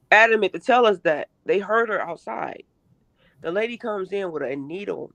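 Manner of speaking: usual speaking voice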